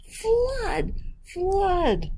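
very high, drawn-out mock alarm; cried alarm. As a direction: wail a hammed-up warning, sky-high. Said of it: "he cried".

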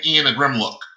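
ordinary delivery, nothing marked

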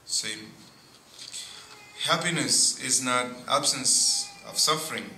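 ordinary talk

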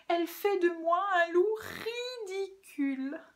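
high-pitched, loud whining tirade; hammed-up tearful protest; tearful complaining wolf. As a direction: wail your grievances like a bullied crybaby. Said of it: "Qu'est-ce qui te fait donc pleurer comme ça".

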